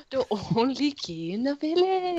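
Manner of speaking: put-on Welsh accent. Quoting Little Britain for friends, soft Welsh accent. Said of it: "that's a good Welsh accent".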